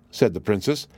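plain reading voice

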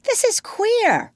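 high, slow bewildered voice; gasped astonishment. A narrator's reading. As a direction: marvel at it, taken aback. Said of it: "Smee gasped".

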